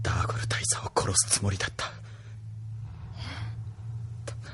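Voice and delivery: hushed, breathless whisper; whispered confession. Acted. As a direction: barely voice the admission. Defeated confession after failed assassination. (ささやき声で打ち明ける)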